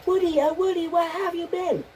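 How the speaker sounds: very high falsetto calling. Pretend. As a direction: squeak it out way up high. Repeat, much higher.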